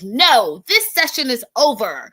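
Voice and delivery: loud, high indignant outburst; indignant yell. Retold or imitated. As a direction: yell it in outrage. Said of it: "she's like".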